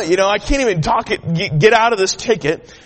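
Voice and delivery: ordinary delivery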